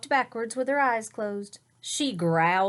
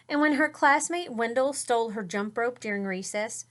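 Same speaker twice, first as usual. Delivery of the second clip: usual speaking voice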